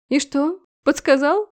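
язвительный вопрос чуть выше; уколоть с издёвкой; едкая насмешка. Acted. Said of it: "едко хмыкнула Элеста между всхлипами". (невеста язвит сквозь всхлипы)

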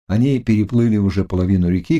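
ordinary delivery, nothing marked

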